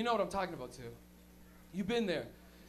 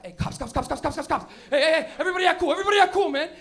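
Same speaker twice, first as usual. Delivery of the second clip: rapid-fire alarm, building louder; panicked warning; 3 semitones higher, 10 dB louder than usual. Acted. Front passenger spots cops and warns the car, acted panic. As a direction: blurt it out, growing louder and more urgent.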